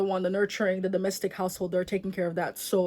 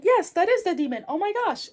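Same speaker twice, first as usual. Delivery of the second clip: mock excited mom; 10 semitones higher, 4 dB louder than usual. Mom voiced in retold story.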